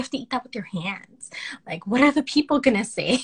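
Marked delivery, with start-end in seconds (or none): 0.0-1.9 whispered worry. Retold or imitated. Whispering her fear of eating with hands in public. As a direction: hush it like a secret fear.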